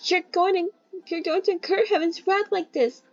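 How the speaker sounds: high-pitched urgent warning; girl's high cry. Acted. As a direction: warn him in a high girlish voice.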